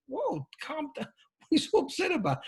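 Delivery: hammed-up bafflement in a high, hushed voice; mock placating. Acts baffled partner placating upset spouse.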